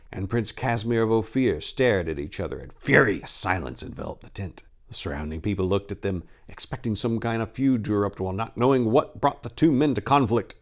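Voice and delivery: ordinary delivery, nothing marked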